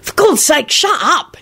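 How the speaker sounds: high, exasperated groan. A narrator's reading. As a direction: groan it, at the end of your patience. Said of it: "he groaned".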